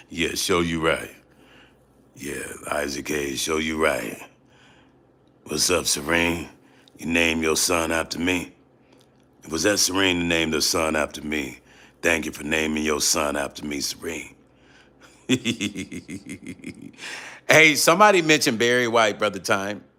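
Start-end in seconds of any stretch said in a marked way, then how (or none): 0.1-14.3 deep, soft soul-man voice. Acted. Thanking [name] for naming a son, deep soft voice. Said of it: "Oh, yeah, [name]". 15.3-16.7 soft chuckle. Acted. Chuckle closing the deep impression.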